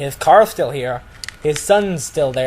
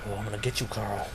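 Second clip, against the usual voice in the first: low, much softer mock muttering to himself; muttered under breath; 6 semitones lower, 15 dB quieter, much faster than usual. Manager muttering a threat to himself, soft low mock mutter. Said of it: "muttering weird stuff under his breath".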